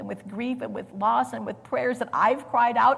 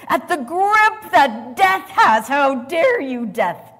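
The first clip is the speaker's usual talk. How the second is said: louder and higher, righteous fury; roaring sermon peak; 6 semitones higher, 10 dB louder, slower than usual. Acted. Preacher voicing Jesus' defiance of death, roaring. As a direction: thunder it at death itself.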